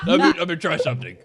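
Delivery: quick, loud imitation of a caller; mock urgent plea. Host mimics a caller stalling, hurried mock plea. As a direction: rush it like a pleading caller. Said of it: "to be like".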